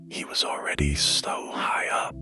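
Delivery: usual speaking voice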